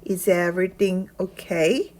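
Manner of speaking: "Is everything" run together as one word, read slowly; slow teacher model with "Is everything" linked. Shown as a demonstration. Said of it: "Is everything 하나로 연결해야 됩니다".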